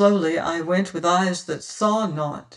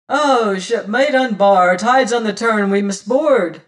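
loud, raised hail; 3 semitones higher, 6 dB louder than usual. A narrator's reading. Adam hails Martin through the door, loud hailing call. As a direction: call out urgently to a shipmate. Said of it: "I heard Adam hailing me".

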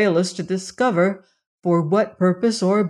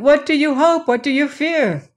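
much higher, insistent questions; anguished cry; 8 semitones higher, 3 dB louder than usual. A narrator's reading. Son begging his father for answers, agitated cry. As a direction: plead urgently, voice lifted high. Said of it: "he cried".

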